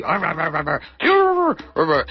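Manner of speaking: romp and growl like a coyote; coyote noises. Pretend. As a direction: arr arr coyote play-growl. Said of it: "romps around like a coyote".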